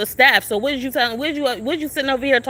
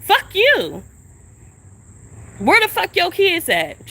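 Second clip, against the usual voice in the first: angry outburst; 5 semitones higher, slower than usual. Real, not acted. Cursing at the woman.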